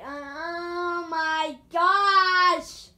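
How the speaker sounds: singsong exclamation. Real, not acted. Stretched cry at the climb.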